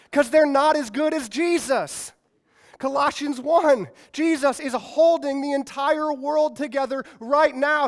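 loud, driving preacher build; preaching at full force. Acted. Preacher firing up the crowd with Thor and Jesus, loud and driving. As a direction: preach it hard, rising to the climax.

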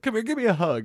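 mock big-hearted cop going in for a hug; imitating the stepdad. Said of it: "he's like".

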